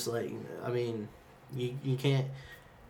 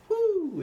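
high, louder "woo"; exclaimed woo; 4 dB louder than usual. Real, not acted. Teammate recalling sixth grade year, a high whoop. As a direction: let out a high-pitched whoop.

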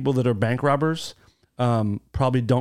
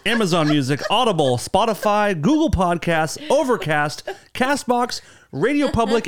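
each platform name climbing higher; sing-song list; 7 semitones higher, slower than usual. Acted. Host listing podcast apps to listeners, climbing sing-song. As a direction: ham up the list, rising each time. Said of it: "listing platforms in climbing voice".

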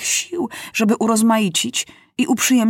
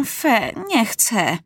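disgusted refusal; 2 semitones higher, much slower than usual. A narrator's reading. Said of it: "krzyknął Maciuś".

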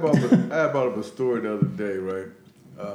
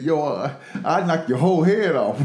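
put-on loud brash New York accent; mock tough New Yorker, louder; 3 semitones higher, 5 dB louder than usual. Retelling the mom's comeback in a loud New York accent. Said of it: "here comes the New York accent".

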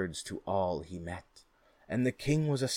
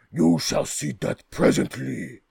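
loud, outraged ogre cry; dim slow booming giant; 5 semitones higher, 10 dB louder than usual. Acted. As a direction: bellow it like an insulted giant. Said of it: "cried the ogre".

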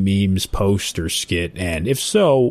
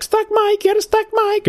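high mock controller call; squeaky mock radio call; ATC radio voice.

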